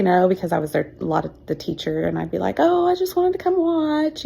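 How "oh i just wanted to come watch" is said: high, breezy innocent voice; innocent excuse. Retold or imitated. Teacher hiding her secret from a parent, airy and high. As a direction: toss it off like nothing is going on.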